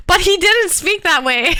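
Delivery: high-pitched, breaking into laughter; laughing. Real, not acted. Host recalls coworker's fake accent, laughing. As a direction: say it through laughter, pitch jumping up. Laughing while telling funny story.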